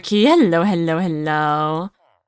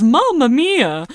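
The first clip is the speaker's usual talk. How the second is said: slow, higher-pitched mock movie exclamation; imitated film catchphrase; 5 semitones higher, 3 dB louder, much slower than usual. Streamer mimics Mario's cut-in line, drawn-out imitation. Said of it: "and he just goes".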